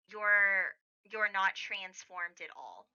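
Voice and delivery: ordinary talk, nothing marked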